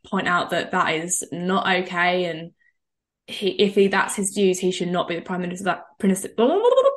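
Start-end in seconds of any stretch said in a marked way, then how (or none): 6.4-6.9 mock blah blah. Mocking filler while correcting herself.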